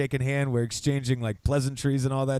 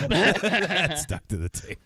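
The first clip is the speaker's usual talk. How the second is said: laughing; 3 semitones higher, slower than usual. Real, not acted. Laughing after joke.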